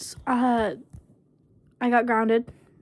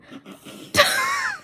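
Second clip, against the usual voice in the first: high giggle. Real, not acted. Laughing at her own hunger line.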